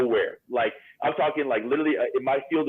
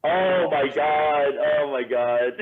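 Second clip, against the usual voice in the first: loud, slow, stretched-out exclamation; drawn-out exclamation; 3 semitones higher, 4 dB louder, much slower than usual. Real, not acted. Bouncer reminded of an old bar fight, drawn-out exclamation. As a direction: stretch it high and loud, then ease off.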